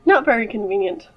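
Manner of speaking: ordinary delivery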